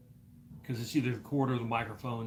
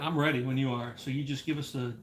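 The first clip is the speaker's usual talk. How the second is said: ordinary delivery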